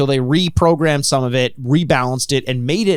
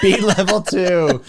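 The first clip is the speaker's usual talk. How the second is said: laughing; 5 semitones higher, slower than usual. Pretend. Echoing robot answer laughing.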